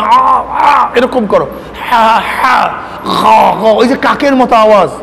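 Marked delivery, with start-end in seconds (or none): 0.0-3.8 letter drill called out to students; teacher sounding Ain. Shown as a demonstration. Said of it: "আইনকে উচ্চারণ করার জন্য".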